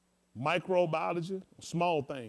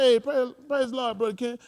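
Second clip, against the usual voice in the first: high, loud hearty church greeting; mock hearty greeting; 10 semitones higher, 8 dB louder than usual. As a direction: greet him like an overjoyed pastor. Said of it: "I said".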